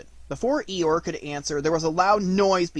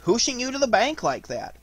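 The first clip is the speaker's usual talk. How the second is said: high, eager animal character; proud, high-pitched explanation; pleased Rabbit; 9 semitones higher, 3 dB louder than usual. Acted.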